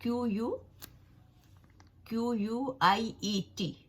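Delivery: letters spelt one by one, slowly; teacher spelling the word letter by letter. Shown as a demonstration. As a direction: spell it out for the class. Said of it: "उसकी spelling है".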